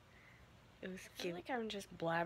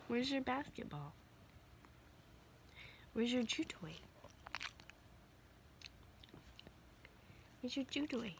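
higher, sing-song coaxing pet voice; baby talk to a dog; 4 semitones higher, 4 dB quieter than usual. Acted. Owner coaxing her dog Willow to find her toy, higher sing-song pet talk. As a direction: coax the puppy to fetch her toy.

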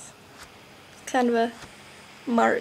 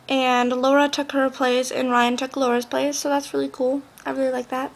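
ordinary talk, nothing marked

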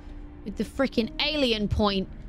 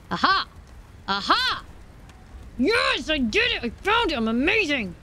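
triumphant excitement; 7 semitones higher than usual. Real, not acted. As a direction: crow with delight at the win. Finds shelter, celebrates.

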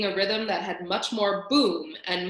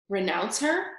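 plain reading voice

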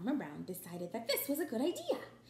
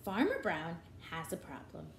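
ordinary delivery, nothing marked

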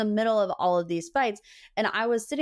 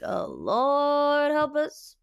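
a hammed-up little prayer, pitched higher; mock dread; 6 semitones higher, much slower than usual. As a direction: pray to be spared, half joking. Mock dread at coming drama.

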